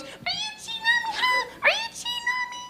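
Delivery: jealous lover's falsetto, way up high. Pretend. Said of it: "make her voice real high for that".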